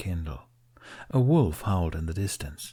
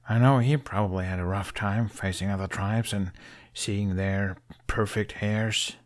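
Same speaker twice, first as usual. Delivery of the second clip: bitter. A narrator's reading. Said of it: "Bitterness crept into his voice".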